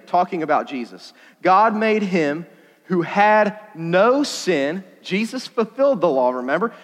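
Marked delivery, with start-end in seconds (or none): 1.4-4.8 "no" stretched and lifted, very slow; slow scripture reading. Acted. Preacher reading the verse to the youth, slow and weighty. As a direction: read it slow, press on "no".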